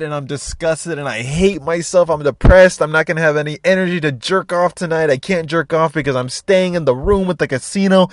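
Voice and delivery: high, worked-up self-loathing rant; hammed-up distress of a glutton; overstuffed despair. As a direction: gasp in, then wail through the misery. Acts out himself overstuffed and despairing.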